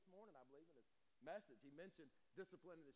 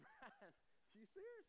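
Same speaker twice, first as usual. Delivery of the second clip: can't believe he wants more; incredulous surprise; 3 dB quieter, slower than usual. Retold or imitated. Pastor asking Brian about a second bowl, incredulous and high. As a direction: pitch leaps way up on Brian, stunned.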